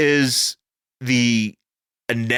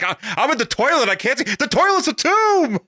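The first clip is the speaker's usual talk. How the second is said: high melodramatic cry, building higher; mock panic; 12 semitones higher than usual. Spider-Man in the dark toilet, mock panic. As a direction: build to a long high wail, hamming it up. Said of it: "he's just like".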